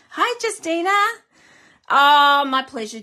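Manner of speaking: lifted voice, gushing thanks; high greeting. Real, not acted. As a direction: chirp a delighted welcome.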